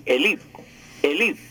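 elite said e-LI-te, stress on the LI. Shown as a demonstration. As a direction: lean on the I of elite. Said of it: "con acento en la I".

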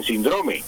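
"síndrome" said sin-DRO-me; Spain's stress. Shown as a demonstration. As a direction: put the weight on the DRO of "síndrome". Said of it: "en España dicen".

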